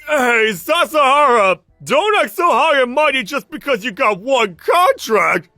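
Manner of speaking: slow, high, very loud tirade; drunken angry yelling. Acted. Drunk senior attacking colleague over one contract, yelling. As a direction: drag out the words in a jealous drunken shout.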